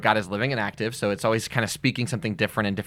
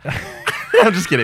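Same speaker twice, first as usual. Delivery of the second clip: laughing; 9 semitones higher, 7 dB louder, slower than usual. Real, not acted. Teasing laugh after joke.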